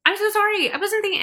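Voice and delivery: imitating mom's flustered apology; mom apologizing. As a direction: gush out sorry like a flustered mom. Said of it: "right away, she's like".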